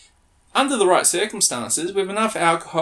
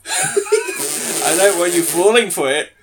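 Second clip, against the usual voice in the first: laughing through the line, slow; cracking up; 3 semitones higher, 6 dB louder, slower than usual. Real, not acted. Host botches the pickup line, laughing helplessly. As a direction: try to read it out while breaking into laughter.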